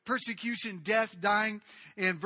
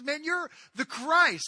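excited declaration; 8 semitones higher, 9 dB louder, slower than usual. Acted. Peter jumps up and declares.

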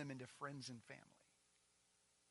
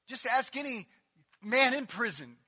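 loud, driving sermon cadence; preaching at full force; 9 semitones higher, 16 dB louder than usual. Acted. Pastor stirring the congregation, full force preaching. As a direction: hammer each phrase to the congregation.

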